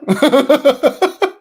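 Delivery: high, loud burst of real laughter. Real, not acted. Streamer amused at being taken for Korean, loud laughing. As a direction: crack up in a high cackling run.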